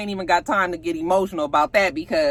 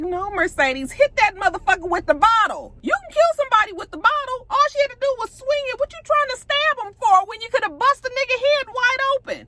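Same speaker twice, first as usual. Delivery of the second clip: shouting at the TV. Real, not acted. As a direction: yell advice at the screen like a fan. Yelling advice at the screen.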